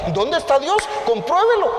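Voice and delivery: mocking skeptic. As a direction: challenge mockingly, voice rising high. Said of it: "te argumenta".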